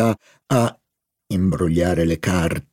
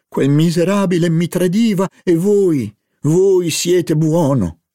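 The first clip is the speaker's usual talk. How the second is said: higher, louder, slowing on voi siete buono; sincere exclamation; 10 semitones higher, 3 dB louder than usual. Acted. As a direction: blurt it out with heartfelt gratitude. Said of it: "esclamò con sincerità Charlie".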